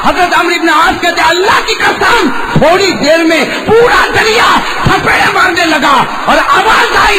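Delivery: high, loud, impassioned sermon cry; fiery preacher at full pitch; preaching at full force. Acted. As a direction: thunder the miracle out to the crowd. Preacher building to climax.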